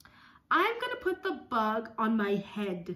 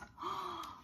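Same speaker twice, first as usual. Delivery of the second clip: awed gasp; 8 semitones higher, 10 dB quieter than usual. Acted. Awe at the sky.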